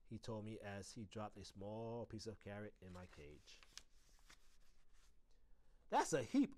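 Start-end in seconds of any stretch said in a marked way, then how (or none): 5.9-6.5 high, loud little squeak; squeaked thanks. A narrator's reading. Small pet thanking the custodian for carrot, squeaky. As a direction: pipe it up like a tiny pet. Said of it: "I squeaked".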